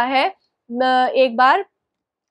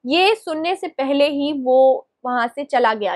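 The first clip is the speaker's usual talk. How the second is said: ordinary delivery, nothing marked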